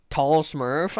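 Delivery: ordinary delivery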